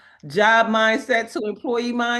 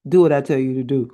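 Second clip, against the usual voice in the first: low, louder, fast divine command; commanding voice of God; 7 semitones lower, 5 dB louder, much faster than usual. Retold or imitated. God ordering her former spouse, low and commanding. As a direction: boom it out as God giving orders.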